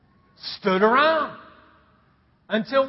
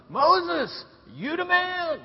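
high, drawn-up mock holler; crowd cheer; 8 semitones higher, much slower than usual. People of Israel hail Moses, high cheering call. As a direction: call out praise like an admiring crowd. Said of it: "they all start telling Moses".

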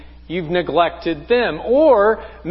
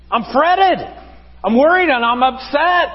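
high mock-anxious answer, FRETTED insisted; hammed-up fretting, pitched well above normal; freaking out; 7 semitones higher than usual. Fretful Southerner answering the question, mock freak-out. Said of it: "freaking out".

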